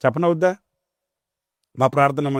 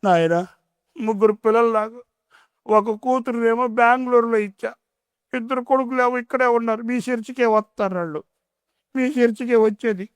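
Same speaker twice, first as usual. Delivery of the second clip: put-on much higher, plaintive old woman's voice; higher-pitched complaining old woman; old mother; 8 semitones higher than usual. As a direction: play the grieving old mother complaining to the pastor. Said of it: "ఆమె ఏం చెప్పిందో తెలుసా".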